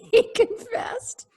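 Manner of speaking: high, slow, laughing question; laughing disbelief. Real, not acted. Host reacting to the burglar confessing, laughing in disbelief. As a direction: laugh out the surprise.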